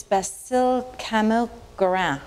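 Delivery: attempted French accent, slightly awkward; bad French accent. Shown as a demonstration. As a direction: say the name with an amateur French accent. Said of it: "Pardon my bad French accent".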